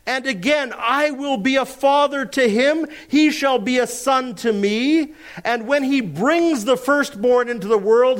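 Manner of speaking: high, ringing pulpit reading; proclaiming scripture. Acted. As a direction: declaim it as God's own decree. Reads God's words aloud, preaching.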